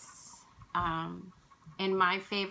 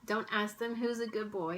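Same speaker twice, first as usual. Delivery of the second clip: ordinary talk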